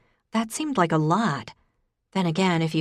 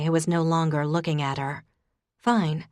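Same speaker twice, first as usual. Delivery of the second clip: ordinary delivery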